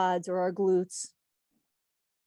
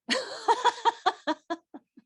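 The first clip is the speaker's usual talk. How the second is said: bursting into a high giggle; teacher cracks up at her own slip, high giggle; sudden high laugh; 4 dB louder than usual. Real, not acted. Teacher laughs at saying 'back strap', high burst of giggling.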